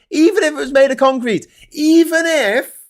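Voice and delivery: high, loud, ranting; building outrage, pitched high and loud. Retold or imitated. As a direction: push it up into a shrill-free high rant. Rising rant, voice climbs high.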